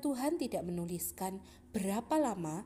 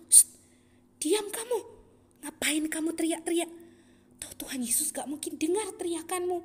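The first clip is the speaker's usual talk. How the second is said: scolding crowd; 6 semitones higher than usual. Acted. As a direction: shush the noisy beggar. (orang banyak menegur, agak tinggi)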